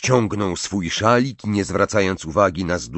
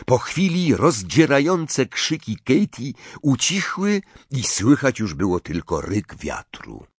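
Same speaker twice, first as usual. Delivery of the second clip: ordinary delivery, nothing marked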